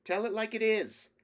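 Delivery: high, loud woman's voice from the crowd; woman calling out. Acted. Middle-aged lady in the audience urging the speaker on, high called-out voice. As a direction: call up to the stage in a woman's voice. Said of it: "a slightly less emphatic voice called up, a woman's voice".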